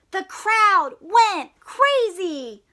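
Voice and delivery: slow, loud, high storytelling; excited reading. A narrator's reading. Reading the crowd's cheer to children, slow and loud. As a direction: stretch it out with big excitement.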